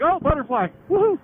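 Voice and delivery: high, distracted sing-out capped with a woohoo; spacey daydreamer. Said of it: "I'd rather be a Spacey Casey".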